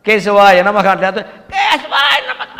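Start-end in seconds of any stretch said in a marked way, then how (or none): 1.1-2.6 high, drawn-out screechy chant; screechy throat. Shown as a demonstration. Priest chanting without sipping water, screechy dry-throated voice. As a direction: squawk it out slowly from a dry throat. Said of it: "గీచు గొంతుతోటి".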